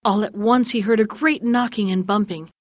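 ordinary delivery, nothing marked

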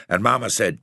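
plain reading voice

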